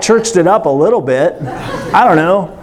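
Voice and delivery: ordinary delivery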